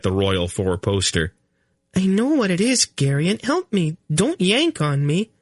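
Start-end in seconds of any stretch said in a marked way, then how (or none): 1.9-5.3 put-on high, strained young queen voice, pitched way up; a young queen in labour, snapping at her flustered husband. Queen Ce'Nedra to Garion, high and urgent.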